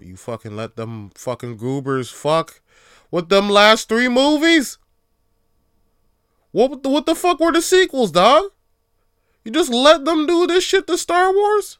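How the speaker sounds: high, loud outraged yelling; rising into a shouted outrage at Lucas; furious rant. Real, not acted. Yelling at George Lucas over the sequels, furious and high.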